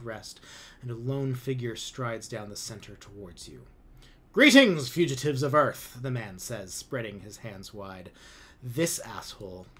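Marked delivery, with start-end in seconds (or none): 4.3-6.0 much higher, much louder grand greeting; pompous commander. A narrator's reading. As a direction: arms wide, hamming up his welcome.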